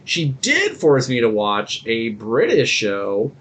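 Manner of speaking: ordinary talk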